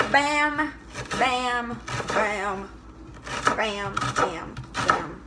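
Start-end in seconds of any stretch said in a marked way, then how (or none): none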